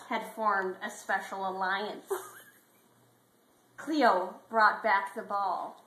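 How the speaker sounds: ordinary talk, nothing marked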